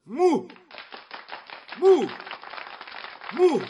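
high, loud isolated bursts, long gaps between; stuck, high-pitched loud outbursts; hiccup-like syllable. A narrator's reading. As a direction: repeat the false start like hiccups. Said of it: "hıçkırık tutmuş gibi".

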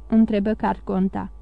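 ordinary delivery, nothing marked

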